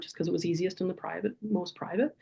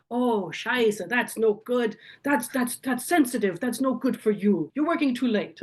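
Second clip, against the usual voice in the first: higher-pitched imitation of a German father; German accent; 5 semitones higher than usual. Father hears of daughter's accident, reacting in a German accent. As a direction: put on Dad's German accent, voice lifted. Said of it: "his wonderful German accent".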